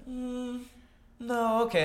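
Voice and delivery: high, soft hesitant murmur; lightly hesitant, pondering; imitating thinking. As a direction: mimic an assistant pausing to think. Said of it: "Did it just think for a second?".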